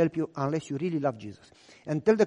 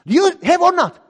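high, loud demand; angry police shout; 12 semitones higher, 8 dB louder than usual. Retold or imitated. Police officer yelling at calm father. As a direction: yell it, losing patience.

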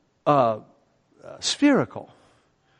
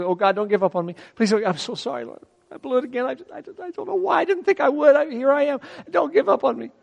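pleading prayer; 5 semitones higher than usual. Retold or imitated. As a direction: play a sinner begging God not to give up. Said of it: "kneel and just plead with".

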